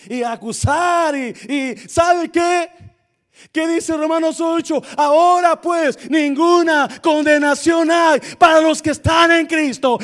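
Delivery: high, loud, slow, shouted preaching; preaching at full force. Acted. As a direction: hammer it home from the pulpit. Predica a pleno pulmón. (predicación a gritos, aguda y lenta)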